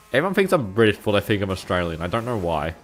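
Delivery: ordinary delivery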